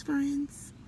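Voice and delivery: ordinary delivery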